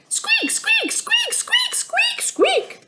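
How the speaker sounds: very high squeaky rabbit chorus; rabbit squeaks. A narrator's reading. Rabbits inside the lean-to squeaking, very high and squeaky. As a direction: squeak like a crowd of frightened bunnies. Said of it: "The squeaking of dozens of rabbits".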